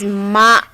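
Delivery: ma said as a clean m plus open a; Japanese syllable ma. Shown as a demonstration. Teacher modelling ma for learners. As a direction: pronounce ma slowly and clearly. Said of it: "it starts with the consonant sound m".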